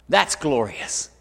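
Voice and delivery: usual speaking voice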